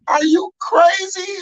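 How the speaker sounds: high, slow disbelieving yell; incredulous shout. Retold or imitated. Retelling grief to a podcast host, an incredulous shout. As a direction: shout it like they've lost their mind. Retelling outrage at dismissive people.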